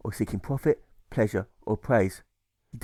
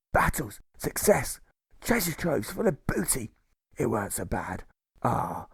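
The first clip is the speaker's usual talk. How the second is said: put-on old sea dog remembering the war; pirate jailer recalling war glory and gore; pirate reminiscing; 2 semitones higher than usual.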